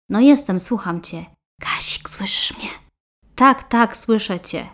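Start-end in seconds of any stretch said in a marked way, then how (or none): 1.6-2.7 secret phone whisper; whispering so mother won't hear. Acted. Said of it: "Mówię cicho, żeby mamusia nie słyszała".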